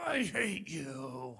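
put-on slow, sulky kid's voice; child's "I hate you". Mom hears her kid say it slow and sulky. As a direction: drag it out like a sulking child. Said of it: "the first thing you hear is".

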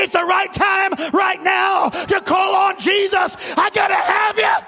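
shouted, rising revival sermon; full-force preaching. Real, not acted. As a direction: build to a desperate, high-pitched plea.

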